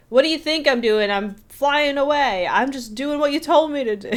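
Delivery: hammed-up indignant retort; mock sassy yell. Imitating someone yelling back at a nagging question, loud and mocking.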